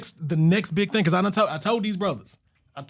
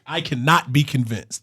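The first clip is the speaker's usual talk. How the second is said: slow and deliberate; 7 semitones lower, slower than usual. Shown as a demonstration. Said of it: "I gotta do mine then".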